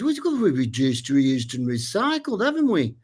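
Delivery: lightly voiced Liverpool builder. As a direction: Liverpool accent with a big upward flip at the end. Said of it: "almost Liverpool accent".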